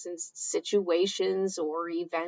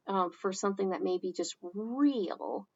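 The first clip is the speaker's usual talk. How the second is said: ordinary delivery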